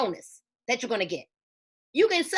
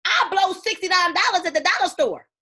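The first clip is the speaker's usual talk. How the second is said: scoffing disbelief; 5 semitones higher, 4 dB louder than usual. Real, not acted. Scoffing at cheap offer.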